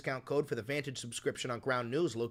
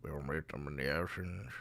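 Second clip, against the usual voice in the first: Winston Churchill impression; 9 semitones lower, 7 dB quieter than usual. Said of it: "is that Winston Churchill?".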